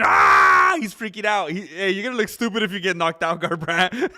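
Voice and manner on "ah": unvoiced mock shout of panic; imitating a fighter's startled yell. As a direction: bark out a quick fake scream. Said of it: "He's going".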